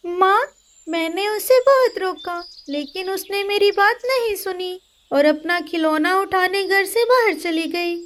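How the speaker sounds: put-on high boyish explaining voice; a son excusing himself; boy's voice. Tilu telling his mother what happened, high child voice.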